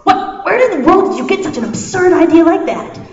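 high, loud appalled outburst; acted indignant disbelief; shocked and disgusted. A narrator's reading. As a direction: blurt it out, scandalised. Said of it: "widened in shock and disgust".

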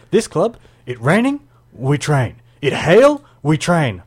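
put-on Macedonian accent. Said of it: "this is my Macedonian accent".

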